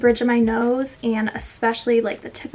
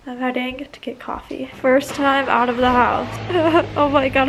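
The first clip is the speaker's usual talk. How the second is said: normal talking voice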